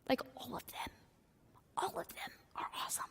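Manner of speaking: hushed whispered aside. Real, not acted. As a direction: whisper it like a secret. Whispered aside about churches.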